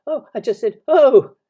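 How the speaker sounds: high, loud flustered squeak; nervous. A narrator's reading. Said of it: "said Piglet nervously".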